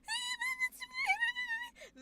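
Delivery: very high, quavering squeal; long panicked comic wail; imitated anime wail. Streamer imitating Demon Slayer's lightning kid, high panicked wail. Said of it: "where he's always like".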